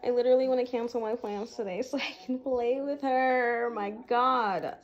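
loud, giddy excitement; excited yelling. Real, not acted. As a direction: shout it with delight. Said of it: "my true honest reaction".

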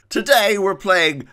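high, loud, drawn-out intro yell; hyped intro. Acted. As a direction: burst into the intro like a wild host.

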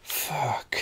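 soft, under-the-breath swearing; whispered curse. Real, not acted. To himself after the character slips, a whisper. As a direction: whisper it to yourself, deflated.

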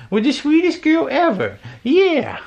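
very high doting coo to the dog. Real, not acted. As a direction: gush over the sweetest dog in a squeaky voice.

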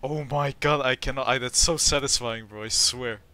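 ordinary delivery

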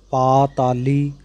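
ਪਾਤਾਲੀ said slowly with a full long aa after ਪ; ਪਾਤਾਲੀ slow and deliberate, every vowel full length; careful pronunciation. Shown as a demonstration. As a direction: stretch the kanna in ਪਾਤਾਲੀ. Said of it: "ਇੱਥੇ ਧਿਆਨ ਦੇ ਕੇ ਪਾਠ ਕਰਨਾ".

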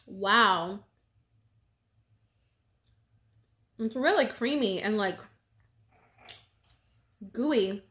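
talking while chewing chocolate; muffled by food in mouth; mouth full, chewing. Real, not acted. Seen 1: Holds chocolate, bites it around 1.7s, then chews while talking.

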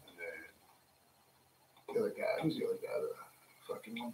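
whispered fumbling for a name; under breath. Real, not acted. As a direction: mutter to yourself as you search for the name. Searching memory under breath.

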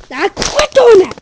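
sudden loud high yelp; startled. Real, not acted. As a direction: yelp as the camera catches you out. Startled by camera.

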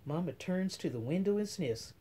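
ordinary delivery

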